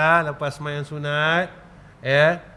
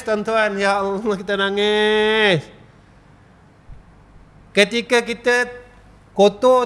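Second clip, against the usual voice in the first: rising higher and louder, slowing hard on the final nangis; impassioned preaching; 6 semitones higher, 3 dB louder than usual. Acted. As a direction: preach it up to a peak. Preacher builds emotional appeal. (ceramah penuh semangat, makin tinggi)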